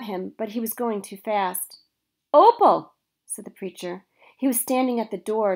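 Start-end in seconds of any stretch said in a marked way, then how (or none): 2.3-2.9 startled call. A narrator's reading. Woken preacher calls daughter.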